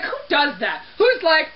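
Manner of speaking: ordinary talk, nothing marked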